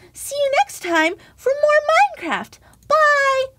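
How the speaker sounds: high, loud, bouncing farewell; waving off the viewers; cheery goodbye. Acted. Host saying goodbye to viewers, cheerful and loud. Signing off the video.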